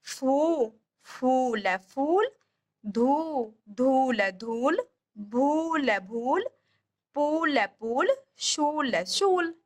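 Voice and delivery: drawing out the long ऊ in फूल, धूल, भूल, पूल, शूल; long uu words demo. Shown as a demonstration. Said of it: "ऐसे बोली जाती है।". (बड़े ऊ को खींचकर बोलना)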